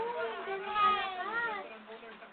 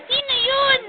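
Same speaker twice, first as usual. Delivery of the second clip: loud, higher call imitating someone asking; quoted question; 3 semitones higher, 13 dB louder than usual. Parent quoting Dadoy's reaction to the baby's shouting, raised voice. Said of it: "Pati si Dadoy, sabi".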